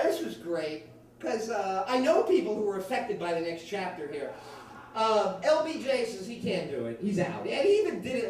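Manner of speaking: normal talking voice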